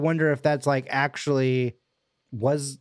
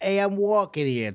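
pitched-up New York "Hey, I'm walking here" bit; New York accent; 7 semitones higher than usual. Shown as a demonstration. Friend riffs on Americans faking accents, in a light New York voice. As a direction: toss it off like a cocky New Yorker. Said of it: "like, that".

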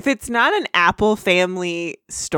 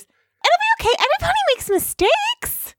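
very high chirpy reassurance; Pinkie Pie voice. Acted. Podcaster as Pinkie Pie comforting Luna, very high and chirpy. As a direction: chirp it brightly to cheer a friend.

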